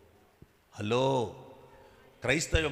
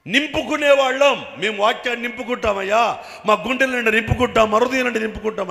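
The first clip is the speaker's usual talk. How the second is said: fervent preaching; 11 semitones higher, 16 dB louder than usual. Acted. Pastor voicing the believers to his flock, loud fervent cry. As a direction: proclaim it as the faithful would.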